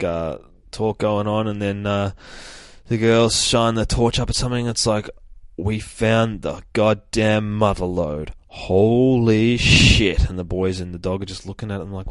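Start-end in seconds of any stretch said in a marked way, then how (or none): 5.6-10.3 drawn-out, slow, louder on the "holy shit"; awed discovery. Acted. Girls finding the supply stash, slow and awed. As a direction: stretch it out in awe.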